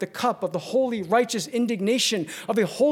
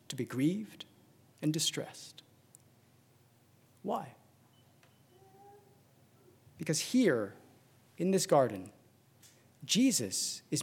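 softer and softer, fading to a hush; 6 dB quieter than usual. Acted. Preacher describing Jesus' grief in the garden, fading hush. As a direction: let the voice sink as the grief begins. Preacher sinks into hushed narration.

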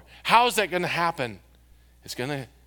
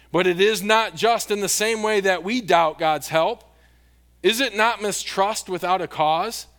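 raised, emphatic preacher reading aloud; impassioned quote reading; 4 semitones higher, 4 dB louder than usual. Acted. Pastor closes with a quote from Spurgeon, reading it with fervour. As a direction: read it out with ringing conviction.

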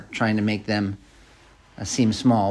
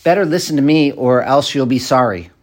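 louder mock threat with an edge; unwholesome threat; 3 semitones higher, 6 dB louder than usual. Shown as a demonstration. Preacher demonstrating a threatening ultimatum, mock angry. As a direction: bark the ultimatum half in earnest.

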